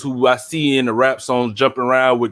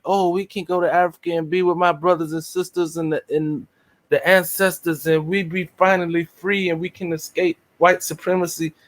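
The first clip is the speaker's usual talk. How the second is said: higher, airy mock of a starry-eyed idealist; mocking dreamer voice; 4 semitones higher than usual. Mocking hoteps dreaming of Africa, in a higher parody voice. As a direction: parody the naive talk of going home to Africa.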